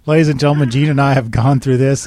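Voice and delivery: announcer voice. Acted. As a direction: introduce us like a host on stage. Host's announcer-style address.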